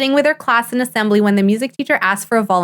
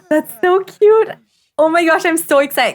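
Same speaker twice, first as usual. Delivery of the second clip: gushing excitement; 8 semitones higher than usual. Real, not acted. Player gushing at her Sims reading to baby. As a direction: melt over how cute it is. Gushing over cute scene.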